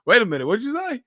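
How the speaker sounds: high, incredulous question; hearing a cruel remark, stunned; mock disbelief. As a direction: act like you can't believe your ears. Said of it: "I'm like".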